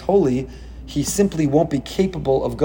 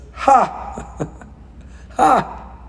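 two high scornful laughs; a sharp "Ha!" laughing at a tyrant's plans; mocking laugh; 10 semitones higher than usual. God laughing at Pharaoh's plans, mocking. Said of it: "Hashem says".

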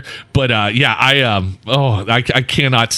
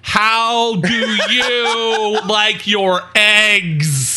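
drawn out, slow and higher; slow mock server; 6 semitones higher, much slower than usual. Friend mocks the server, slow and high pitched. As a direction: stretch every word out like a patient server. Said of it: "Did she do it like this?".

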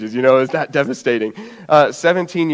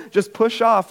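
lightly imitated sister, higher pitch; sister encouraging; 7 semitones higher, slower than usual. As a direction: coax from below in a raised pitch. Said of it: "my sister's".

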